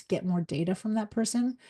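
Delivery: usual speaking voice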